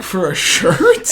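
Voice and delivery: slow, incredulous, half-laughing disbelief; shocked disbelief. Retold or imitated. Friend hears the shirt cost nineteen hundred dollars, answers in shocked disbelief. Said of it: "And I'm like".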